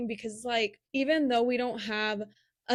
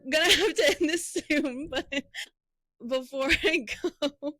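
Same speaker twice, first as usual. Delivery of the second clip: real giggles breaking into the words, higher pitch; laughing; 5 semitones higher than usual. Real, not acted. Podcast host trying to wrap up the episode, laughing. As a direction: try to carry on while still laughing. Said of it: "I'm just laughing".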